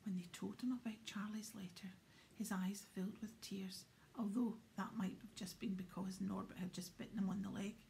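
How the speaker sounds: ordinary delivery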